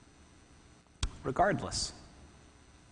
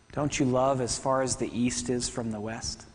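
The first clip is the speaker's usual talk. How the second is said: usual speaking voice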